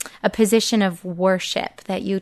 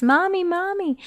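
put-on anxious child; 9 semitones higher, 4 dB quieter than usual. Child calling mom anxiously.

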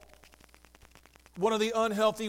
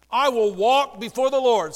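louder, bold confession for all to repeat; loud declaration; 8 dB louder than usual. Shown as a demonstration. Pastor leading the congregation in confession, loud proclaiming. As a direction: proclaim it loudly so they echo. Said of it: "Say that".

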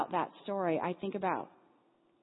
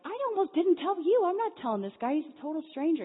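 much higher, louder indignant outburst; angry protest; 10 semitones higher, 6 dB louder than usual. Retold or imitated. Teen angrily refusing to tell the stranger, loud and high. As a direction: refuse hotly, voice climbing. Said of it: "steely, angry eyes like".

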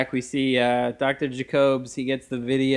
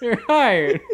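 high mock boss voice; high squeaky voice; 10 semitones higher, 6 dB louder than usual. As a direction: squeal the hiring line. Boss hires Esther.

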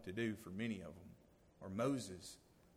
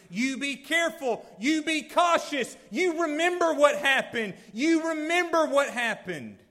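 fervent preaching warning; 13 semitones higher, 13 dB louder than usual. Retold or imitated. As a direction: thunder the warning like Moses to his people. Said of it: "he's preaching his farewell sermon".

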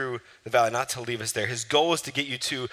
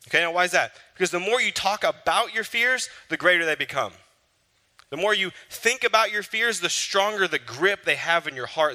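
faster, lifted preacher's push; urgent preaching; 5 semitones higher than usual. Acted. Preacher urging congregation to pray about fears, fast. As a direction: urge them to pray.

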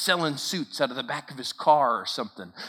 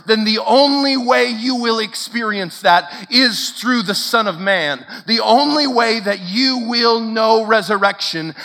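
louder, emphatic pulpit delivery; full-force preaching; 6 semitones higher, 7 dB louder than usual. Acted. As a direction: drive each claim home to the room. Preaching to the congregation.

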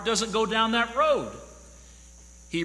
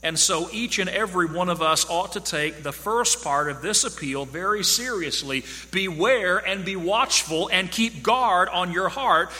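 ordinary delivery

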